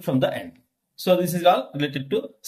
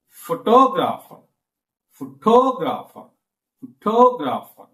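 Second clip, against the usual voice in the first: "Photographer" with TO long and punched; stress on second syllable. Shown as a demonstration. Teacher demonstrating shifting stress, strong second syllable. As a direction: hit the TO of "Photographer", then fall. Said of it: "stress on second syllable".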